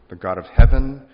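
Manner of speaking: heaven rings out loud from the pulpit; booming proclamation. Acted. Preacher to congregation, proclaiming the God of heaven loudly.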